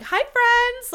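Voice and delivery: high, loud, sing-song influencer hello; influencer greeting. Shown as a demonstration. Wannabe influencer greeting her followers, high and loud. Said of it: "in that exact voice".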